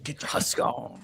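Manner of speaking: hushed unvoiced whisper. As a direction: breathe it out in a whisper. Joking about fiber husks.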